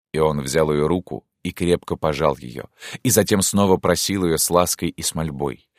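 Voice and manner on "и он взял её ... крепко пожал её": usual speaking voice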